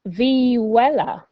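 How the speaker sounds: slow American-accented Vihuela; american accent spanish. Shown as a demonstration. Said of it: "apologise for my Spanish pronunciation".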